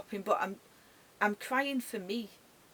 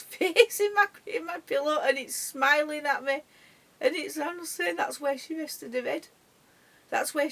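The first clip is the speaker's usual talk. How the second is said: tearful laughter; 5 semitones higher, 5 dB louder than usual. Real, not acted. Sees dog's face in her pillow.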